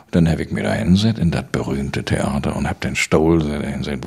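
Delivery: usual speaking voice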